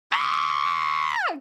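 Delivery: a slow, drawn-out bleating goat scream. Pretend. Thanking a chatter, then a bleating goat scream. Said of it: "Goat scream".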